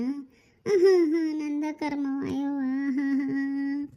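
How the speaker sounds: slow, sobbing lament; crying. Acted. Mother left alone bewailing her fate, crying. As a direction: moan through sobs, sinking away. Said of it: "ಯಾಕೆ ಅಳಕತ್ತಿ".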